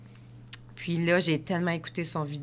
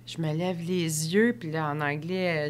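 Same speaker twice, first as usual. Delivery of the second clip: ordinary talk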